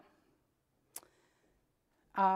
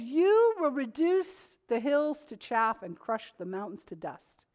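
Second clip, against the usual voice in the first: slower, weighty proclamation; deliberate pulpit reading; slow scripture emphasis. Acted. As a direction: lean on each word of the promise. Rereading the verse slowly.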